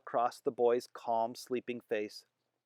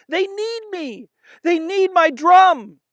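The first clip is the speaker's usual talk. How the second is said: very high, slow, building distress, loud at the end; more and more upset; 11 dB louder, slower than usual. Acted. Boy pleading that soldiers need his drum, slow high building upset. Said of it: "He sounded more and more upset".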